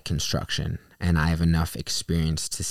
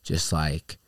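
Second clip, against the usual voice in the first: ordinary delivery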